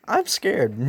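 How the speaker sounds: hammed-up frightened admission; mock tearful fear. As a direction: play scared and about to cry. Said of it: "And he starts crying".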